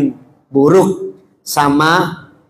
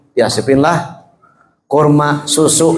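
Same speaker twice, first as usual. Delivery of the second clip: ordinary delivery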